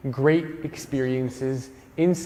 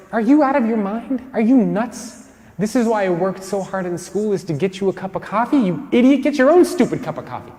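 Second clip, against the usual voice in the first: a loud, higher-pitched incredulous rant; inner outrage; 5 semitones higher, 3 dB louder than usual. Retold or imitated. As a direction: let the inner fury burst out. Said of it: "That's what I said on the inside".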